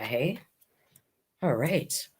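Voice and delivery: ordinary delivery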